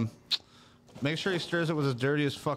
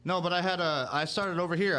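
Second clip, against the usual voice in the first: normal talking voice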